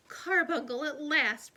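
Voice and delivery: usual speaking voice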